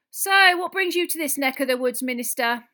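loud, high-pitched barmaid voice; chatty landlady calling to an important guest; Madame Rosmerta. A narrator's reading. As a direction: ask brightly, pitched well up. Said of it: "came Madame Rosmerta's voice".

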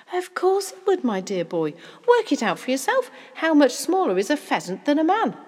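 loud, high, emphatic reasoning; animated father coaxing out the answer; confident, excited father. Acted. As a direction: brush off the doubt with gusto.

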